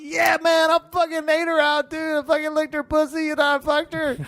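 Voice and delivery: high-pitched, overeager fake boast; hammed-up bragging, voice way up high; mock teenage bragging. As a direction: act out a teen virgin bluffing his conquest. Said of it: "'cause I used to do that shit".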